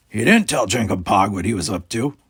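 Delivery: an alien unsure of the plan; puzzled alien. A narrator's reading. Jankum alien voice.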